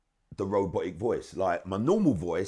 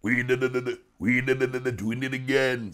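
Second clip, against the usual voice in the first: droning robot voice, a touch louder; robotic voice; 3 semitones higher, 4 dB louder than usual. Shown as a demonstration. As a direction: chant the hook in a robot voice. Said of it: "I could still do this robotic voice".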